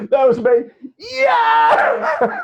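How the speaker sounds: jubilant high shout of triumph; goal celebration. Retold or imitated. Boy cheering his team's goal in the away end, ecstatic shout. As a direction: cheer the goal like you're back in the stand.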